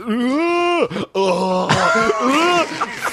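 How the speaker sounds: long, high-pitched straining groans; high mock-effort wails; imitated tennis grunts. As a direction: act out drawn-out cartoon tennis grunts. Imitating Arnold-versus-Stallone tennis act.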